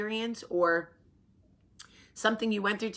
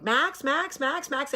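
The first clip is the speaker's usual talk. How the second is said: frantic calling; 7 semitones higher, slower than usual. Retold or imitated. Calling for her lost kid.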